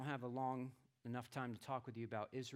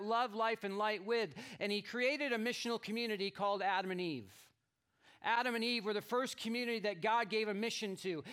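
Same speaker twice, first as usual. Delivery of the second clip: raised, louder preaching voice; 9 semitones higher, 10 dB louder than usual. Acted. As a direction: proclaim the story to the congregation. Preaches the creation story.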